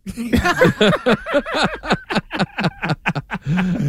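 big laugh at a joke; hearty laugh. Real, not acted. Group laughter at divorce joke.

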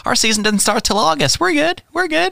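high, breezy and carefree; smug NFL voice. Retold or imitated. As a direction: shrug it off like a relaxed boss. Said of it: "The N F L's like".

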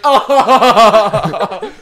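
loud, high laughing yelp; a hammed-up shout of surprise; mock Arnie yelp. Arnie gets ice cream in his face, mock loud yelp. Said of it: "And he's like".

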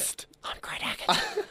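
slow, furtive stage whisper; mock secretive whisper. Plays secret informant tipping off host.

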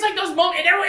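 usual speaking voice

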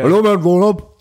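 high, loud acted police yell; barking mock policeman; policeman's wake-up shout. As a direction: shout a sleeper awake as the officer. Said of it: "når du bliver vækket af en politimand".

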